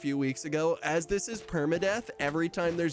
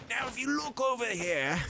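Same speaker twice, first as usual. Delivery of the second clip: higher, lightly voiced Australian wildlife host; a hint of a Steve Irwin Australian accent, pointing something out; Steve Irwin impression; 6 semitones higher than usual. Gamer narrating a mammoth like Steve Irwin, mild Australian accent. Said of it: "I feel like Steve Irwin out here".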